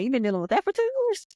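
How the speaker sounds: fast, high incredulous question; can't believe what she's hearing; shocked disbelief. Retold or imitated. Cousin hearing about ten years of abuse, fast shocked disbelief. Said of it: "She's like".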